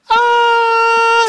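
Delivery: high delighted shriek; excited shriek. Retold or imitated. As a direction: let out a wide-eyed squeal of wonder. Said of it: "I'm just like".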